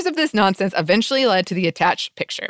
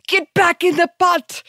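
high yell; 10 semitones higher than usual. Retold or imitated. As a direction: yell it like a witch.

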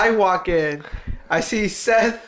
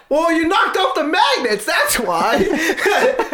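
laughing retold answer; 9 semitones higher, much slower than usual. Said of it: "I'm like".

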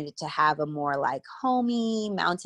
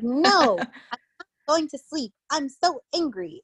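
put-on high, pouting little girl refusing bed; toddler voice; 10 semitones higher than usual. Toddler daughter refusing to sleep, high pouty voice. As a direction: play a stubborn two-year-old, much higher.

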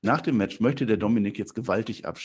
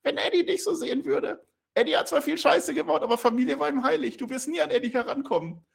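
much higher mock voice, a laugh in it; provoking Rey; 4 dB louder than usual. Rey provoking Dominik about Eddie, high mocking voice. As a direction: needle him in a squeaky taunt. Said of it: "Der Ray provoziert weiter".

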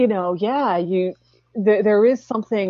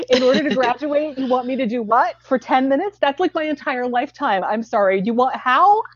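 higher-pitched, incredulous protest; comic indignation, questions rising; mock disbelief; 5 semitones higher than usual. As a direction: play the appalled student balking at the task. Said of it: "it's like".

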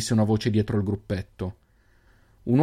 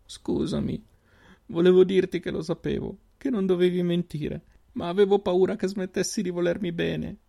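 crying apology; 9 semitones higher than usual. A narrator's reading. Sister crying into her brother's arms, tearful high voice. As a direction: sob out the apology while hugging him. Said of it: "lo strinse piangendo".

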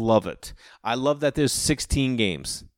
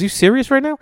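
loud, high disbelieving snap; mock outrage, pitched way up; mad; 6 dB louder than usual. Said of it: "Like, mad at me".

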